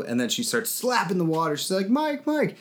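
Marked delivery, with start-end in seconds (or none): 1.9-2.6 high, urgent calling; frantic Kay voice. Retold or imitated. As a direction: call out in a panic.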